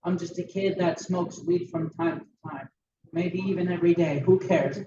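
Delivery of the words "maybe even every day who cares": usual speaking voice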